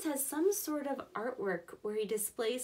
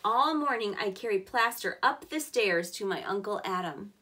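louder, clear storybook reading; reading to children; 2 semitones lower, 5 dB louder than usual. Acted. Teacher reading the boy's workday to kids, louder storybook voice. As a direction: give every step of the workday a clear read. Reads picture book aloud.

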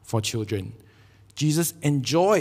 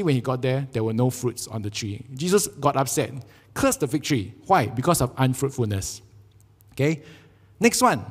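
normal talking voice

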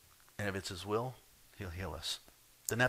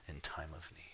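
1 hushed whisper; 5 semitones lower, 16 dB quieter than usual. Acted. Whispered end of quoted confession.

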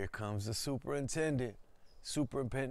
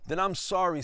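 louder, a defiant apology; a proud, firm no; firm refusal; 7 semitones higher, 8 dB louder than usual. A narrator's reading. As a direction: raise it high and loud, standing firm. Mendez refusing the deal.

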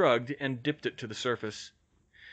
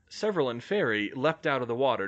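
ordinary delivery, nothing marked

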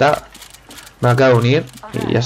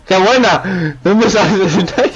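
laughing surprise; 7 semitones higher, slower than usual. Real, not acted. Surprised sniper appears.

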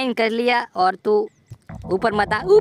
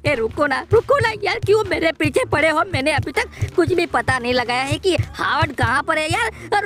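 ordinary delivery

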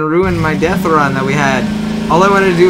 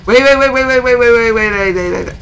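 panicked; 6 semitones higher than usual. Real, not acted. Panicked as car is taken.

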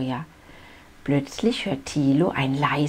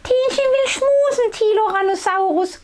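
put-on very high gurgling baby dino voice; 5 dB louder than usual. A narrator's reading. Baby sister wanting to snuggle big brother, high gurgle. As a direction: coo and gurgle like a tiny baby. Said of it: "gluckst Tienchen".

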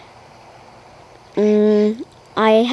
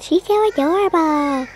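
higher, lightly imitated monster voice; 8 semitones higher than usual. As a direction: recreate the odd monster voice. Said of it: "like, a monster voice whenever I said".

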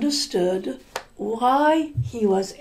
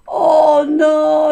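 higher, louder despairing "oh no"; dismayed cry; 5 semitones higher, 8 dB louder than usual. Acted. Miser discovers his gold gone.

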